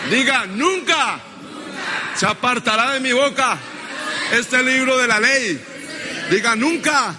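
high, full-force pulpit declaration; insistent preacher's cry; preaching call-and-response. Real, not acted. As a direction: drive each phrase out for the congregation to repeat. Preacher leading chanted declaration.

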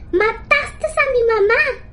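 high, slow shrieking doll; doll screaming. Acted. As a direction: scream it out slowly as a creepy doll. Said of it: "gritó la muñeca".

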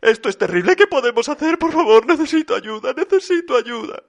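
high, whining, breathless plea; panicked Papá Noel. A narrator's reading. Papá Noel with no presents ready begging for help, high panic. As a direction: spiral into helpless pleading.

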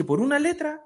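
high, incredulous question; acted parent's high-pitched protest; indignant disbelief. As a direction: query it in outraged disbelief. Indignant parent in read joke.

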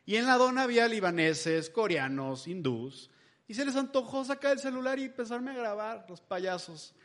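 ordinary delivery

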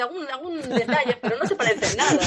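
answering through a laugh; amused, laughing as she answers; laughing reply. Retold or imitated. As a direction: giggle through the words.

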